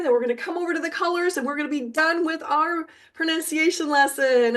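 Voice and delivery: usual speaking voice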